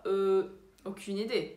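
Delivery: slow, long-held "euh" before the answer; pondering. Shown as a demonstration. Teacher answers about a Christmas gift, pondering slowly. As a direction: stall as if searching, then give up. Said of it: "pensar um pouco e falar".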